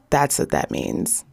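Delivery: usual speaking voice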